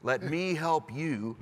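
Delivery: usual speaking voice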